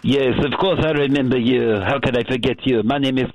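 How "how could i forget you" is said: ordinary talk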